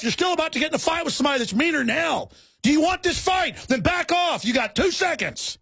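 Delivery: high pitched threatening yell; retold angry shout. Confronting the dog owner on the trail, a high angry shout. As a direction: raise it up high and menace the guy. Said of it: "I'll start screaming at 'em".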